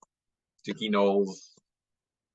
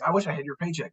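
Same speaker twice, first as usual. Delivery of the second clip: higher-pitched envious quote; wistful envy; 8 semitones higher, faster than usual. Retold or imitated. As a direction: voice a light, mock-wistful wish. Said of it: "I'm like".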